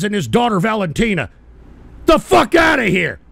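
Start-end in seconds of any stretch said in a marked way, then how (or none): 2.1-3.2 loud dismissive shout; angry outburst. Real, not acted. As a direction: bark them away. Dismissing viewers angrily.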